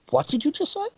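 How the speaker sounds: high, disbelieving question; as if she can't believe her ears; stunned doctor. Retold or imitated. Doctor turning slowly, stunned by the patient's relative. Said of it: "the doctor slowly turns to me and goes".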